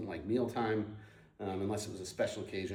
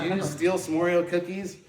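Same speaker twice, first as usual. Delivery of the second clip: laughing through mom's question, higher and louder; can't hold back a laugh as she quotes her mom; 7 semitones higher, 7 dB louder than usual. Real, not acted. Retelling the Oreo theft to the host, laughing.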